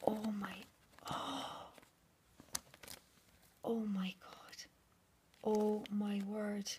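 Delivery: slow breathless amazement; stunned whisper. Real, not acted. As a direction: gasp the words out softly. First sight of gift.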